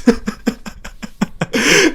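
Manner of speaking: squealing breathless giggle; a giddy high giggle fit; high-pitched giggle. Retold or imitated. High giggle after monkeys line.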